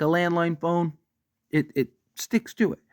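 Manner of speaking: ordinary talk, nothing marked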